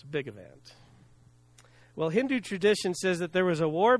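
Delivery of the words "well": ordinary talk, nothing marked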